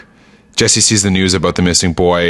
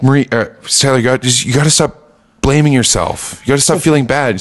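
sister-in-law's voice pitched higher, soothing; Marie consoling; 5 semitones higher than usual. Retold or imitated. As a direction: gently coax her to stop blaming herself. Marie consoling Skylar, retold.

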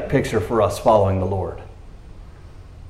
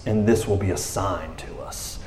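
ordinary talk, nothing marked

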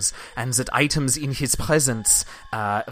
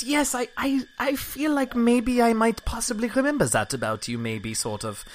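much higher, hesitant woman's voice; awkward NPC woman; 11 semitones higher than usual. Acted. NPC woman pretending to remember her friend, hesitant higher voice. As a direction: fumble for a polite reply.

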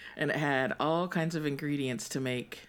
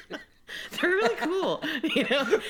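laughing; 12 semitones higher, much slower than usual. Real, not acted. Laughing about t-shirts.

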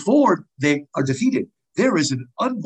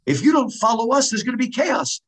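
higher-pitched voicing of the Furies; lightly acted threat, pitched up; Furies' warning; 9 semitones higher, faster than usual. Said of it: "Furies are given some good lines".

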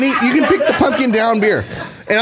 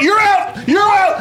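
shouting; 7 semitones higher, much slower than usual. Real, not acted. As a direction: yell it at your friend. Yelling at rival to hurry.

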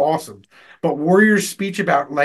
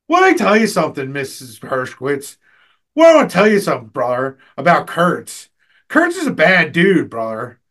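hammed-up wrestler bravado in class; Hulk Hogan; 4 dB louder than usual. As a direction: answer the teacher as if cutting a wrestling promo. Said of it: "answer the teacher and Hulk Hogan".

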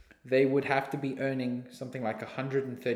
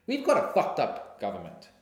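ordinary delivery

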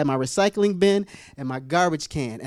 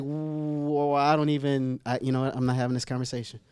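backing off; 6 semitones lower, 5 dB quieter than usual. Retold or imitated. Acts backing out of conversation.

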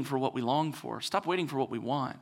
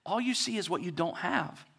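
ordinary talk

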